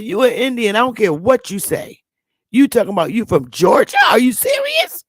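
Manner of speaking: high-pitched disbelief, leaping up at the end; retold incredulity. Teller challenging the man from Georgia, animated incredulous retelling. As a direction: build to an incredulous squeal on the last question.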